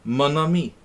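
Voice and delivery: a hammed-up French "Mon ami"; French accent. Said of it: "that's French".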